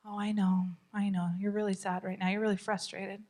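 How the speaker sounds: soft, low soothing calm; empathy voice. Shown as a demonstration. Parent soothing an angry child, soft and calm. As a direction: soothe them gently. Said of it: "master the empathy voice".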